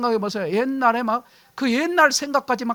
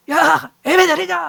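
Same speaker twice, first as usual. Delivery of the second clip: bossy spouse; 6 semitones higher, 7 dB louder than usual. Acted. Acted spouses quarreling over worship.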